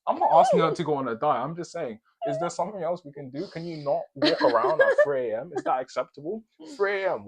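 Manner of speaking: loud, high, laughing disbelief; ranting about the noisy neighbour while laughing hard. Real, not acted. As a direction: rant through the laughter, incredulous. Laughing, incredulous rant about neighbour.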